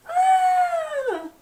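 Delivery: very high, loud imitated whinny; horse whinny. Dizzy's horse as the crowd cheers, shrieking whinny. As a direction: squeal like a horse. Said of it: "You know, the whinnying sound?".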